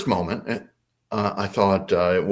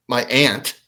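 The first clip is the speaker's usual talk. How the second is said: ordinary talk, nothing marked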